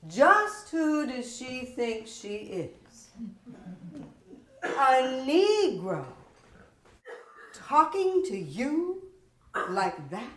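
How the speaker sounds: slow, loud, looming voice of her own prejudice; inner prejudiced voice. Acted. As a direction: let the ugly inner voice rise up, slowly. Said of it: "It had a voice".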